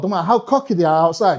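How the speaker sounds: usual speaking voice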